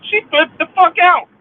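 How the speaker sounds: high, loud yell on fuck; angry shout. Real, not acted. Venting at the sister's betrayal, furious shout. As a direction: let the fury out. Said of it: "let me say it how I really feel".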